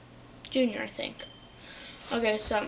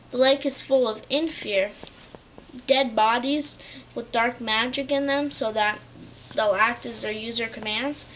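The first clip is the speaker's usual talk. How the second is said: ordinary talk, nothing marked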